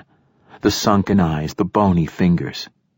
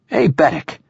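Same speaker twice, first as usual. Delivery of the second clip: short, high-pitched snap; snapped; 9 semitones higher than usual. A narrator's reading. Old poet calling his android to answer, snapping. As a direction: snap the name at him. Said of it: "he snapped".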